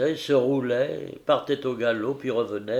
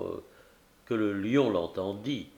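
plain reading voice

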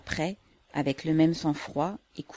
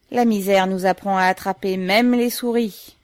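usual speaking voice

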